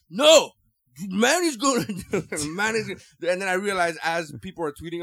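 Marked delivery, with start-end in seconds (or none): 0.1-3.3 loud, high hyped insistence; retelling his pre-fight hype, loudly; hyped-up fan. Retold or imitated. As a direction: sell it like a believer before the fight. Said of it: "I fell into the hype".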